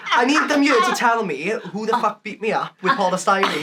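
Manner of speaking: ordinary delivery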